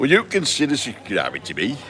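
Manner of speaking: put-on broad Yorkshire accent. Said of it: "In my best Yorkshire accent".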